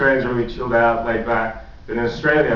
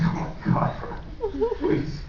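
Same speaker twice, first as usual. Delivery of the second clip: whispered disbelief; 8 dB quieter than usual. Retold or imitated. Friend disgusted by room.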